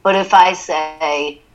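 ordinary talk

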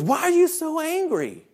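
very high imitated child voice; child's question. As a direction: mimic a kid asking the teacher. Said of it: "And he goes".

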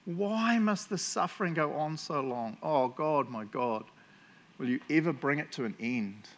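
ordinary talk

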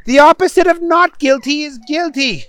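high-pitched judge character; judge correcting. A narrator's reading. Judge correcting a muddled defendant, high-pitched and pointed. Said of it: "said the judge".

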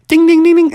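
high, loud bell dings; ding sound. Acted. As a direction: ring out the win. Checkmate sound effect.

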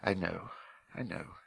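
heavily. A narrator's reading. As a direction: say it quietly, weighed down. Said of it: "he said heavily".

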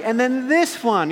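high, loud, eager presenting voice; put-on excited girlish voice; imitated teenage daughter. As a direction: mimic a girl thrilled by her find. Daughters showing the next spider.

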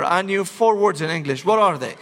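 ordinary delivery

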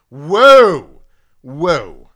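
loud drawn-out whoa, pitch up; indignant call-center agent, loud; East Coast whoa. Retold or imitated. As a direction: cut her off with a loud whoa. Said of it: "here comes the East Coast Ed".

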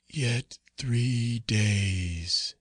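hollow ghost, drawn out very slowly; slow, sepulchral apparition; faint sepulchral voice. A narrator's reading. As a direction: intone it faintly like a voice from the tomb. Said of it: "faint, hollow, and sepulchral".

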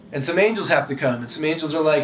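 ordinary talk

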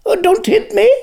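high, loud panicked plea; frightened cry. A narrator's reading. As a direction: cry out, cowering in fear. Conjurer cowers from Lanark.

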